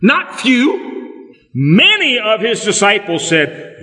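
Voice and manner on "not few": "Not few" pitched high and sharp; emphatic aside. Acted. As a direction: punch the correction. Stresses many over few.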